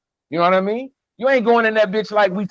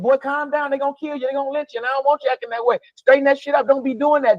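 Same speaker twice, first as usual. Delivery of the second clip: put-on higher-pitched mama warning her boy; worried mama scolding; 6 semitones higher, faster than usual. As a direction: play the mother scolding her son, a little higher. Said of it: "your mama said".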